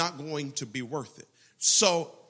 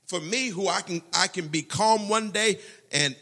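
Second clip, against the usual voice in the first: ordinary delivery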